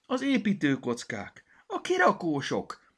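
put-on children shout out one mess after another; excited cries. A narrator's reading. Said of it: "hangzottak fel mindenhonnan a kiáltások". (izgatott, hangos felkiáltások)

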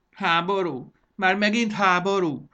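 high, loud petulant whine; put-upon wailing protest; whining. Acted. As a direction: complain like a spoiled child. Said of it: "nyafogott Mondán".

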